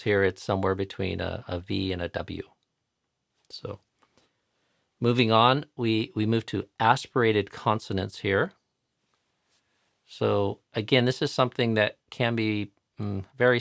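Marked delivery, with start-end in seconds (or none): none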